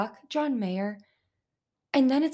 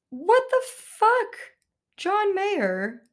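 much higher, louder, slow what the fuck; outraged disbelief; 11 semitones higher, 5 dB louder, much slower than usual. Real, not acted. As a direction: exclaim in shocked disbelief.

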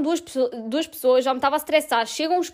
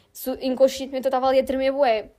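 ordinary talk

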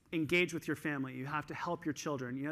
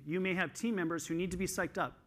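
ordinary talk

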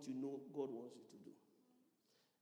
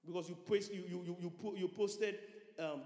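usual speaking voice

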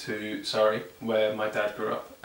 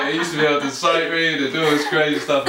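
ordinary talk

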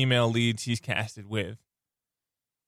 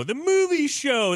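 pitched way up, grand announcement; high excited announcer voice; 12 semitones higher than usual. Shown as a demonstration.

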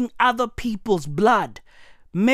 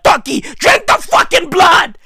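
shouted order; 8 semitones higher than usual. Real, not acted. Comedian yells at Turkey to drink the blood, laughing. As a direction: yell the order at him, then crack up.

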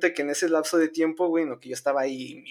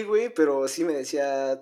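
normal talking voice

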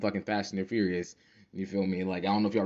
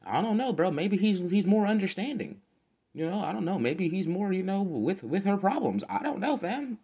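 1 faux-innocent shrug; 10 semitones higher than usual.

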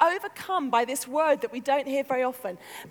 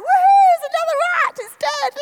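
high, fast mock-child excitement; kids' gleeful cheer; 14 semitones higher, 9 dB louder than usual. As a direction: whoop like thrilled children. Kids thrilled by dead rat.

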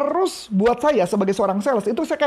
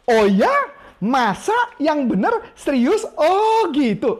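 interested surprise; 6 semitones higher, slower than usual. Shown as a demonstration. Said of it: "Kalau Anda ngomong".

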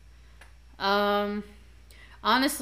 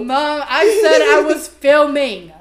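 exasperated call; 6 semitones higher, 15 dB louder, slower than usual. Real, not acted. Joking scold at mother off camera.

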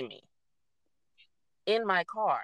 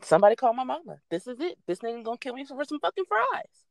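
mock panic; 8 semitones higher, 3 dB louder than usual. Panicking in the car.

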